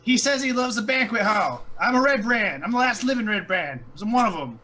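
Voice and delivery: high, loud character voice; high-pitched, loud boasting; hype-man proclamation. Acted. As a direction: call it out to a crowd, insisting. York hypes on to the goblins.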